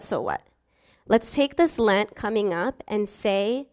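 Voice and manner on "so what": so what dropped much lower and softer. Shown as a demonstration. Preacher shrugs off sin for the congregation, low and soft. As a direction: let the last so what sink low. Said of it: "so what, so what".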